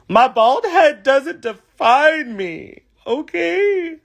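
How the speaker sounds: slow, high, over-the-top proud delivery; hammed-up declaration. Queen telling viewers about her bald head, hammed-up and slow. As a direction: ham it up like a bad soap star. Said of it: "Not an actor".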